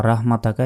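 thin "rah" in রাহমাতাকা; quick, flat "rah" opening রাহমাতাকা; wrong vowel demonstration. Shown as a demonstration. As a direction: say রাহমাতাকা with open a and light h. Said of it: "এটাও বলা যাবে না".

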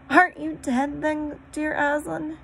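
high astonished question; disbelieving wonder; amazed girl. A narrator's reading. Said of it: "said Lucy".

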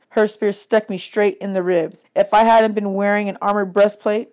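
ordinary delivery, nothing marked

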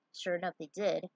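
ordinary delivery, nothing marked

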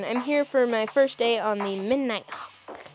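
ordinary delivery

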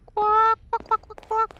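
high-pitched quacking bursts; imitated chicken call. Player luring Chica out, high quacking imitation. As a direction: call out in a high bird squawk. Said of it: "trying to call her in her tone".

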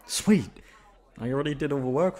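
bursts out high and loud with delight; a happy yelp of relief; excited. Acted. Excited about finished homework.

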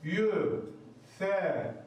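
usual speaking voice

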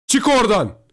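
high, loud urgent order; shouting. A narrator's reading. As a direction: yell a warning to get out. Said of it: "diye bağırdım". (telaşla bağırarak)

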